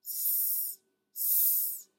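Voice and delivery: short breathy pulses on S; pulsed hiss. Shown as a demonstration. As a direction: pulse the S with empty lungs. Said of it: "continue to pulse on the S".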